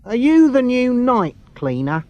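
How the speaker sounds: a touch of a gruff official; station supervisor questioning Craig. A narrator's reading. Station supervisor voiced in story.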